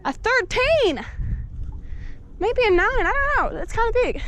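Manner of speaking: high, carrying holler; calling out. Real, not acted. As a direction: shout the answer over to a friend. Calling the size across to friend.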